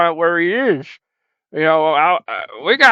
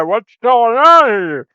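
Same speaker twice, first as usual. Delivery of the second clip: drawn-out, higher puzzled viewer; mock viewer question; 5 semitones higher, 5 dB louder, slower than usual. Host voicing viewers asking what's going on, slow and puzzled. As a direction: play the confused fan at home. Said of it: "I know what you're gonna say".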